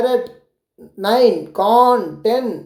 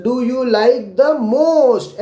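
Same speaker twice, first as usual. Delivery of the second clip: slow question read; much slower than usual. Shown as a demonstration. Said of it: "वो पूछ रहे हैं".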